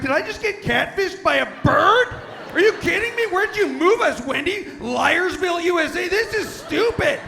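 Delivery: high, loud hammed-up rant; mock outrage. Man ranting at his wife about a catfishing bird, hammed-up outraged yell. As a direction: rant at full pitch, laughing.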